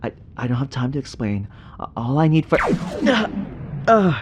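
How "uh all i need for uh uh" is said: loud, higher, breathless orders under threat; urgent commands. Acted. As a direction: bark instructions before the monster strikes. Urgent orders facing a creature.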